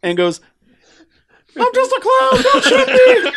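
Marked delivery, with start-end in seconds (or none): 1.6-3.3 very high, loud squeaky clown voice; silly clown voice. Retold or imitated. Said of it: "breaking out a clown voice".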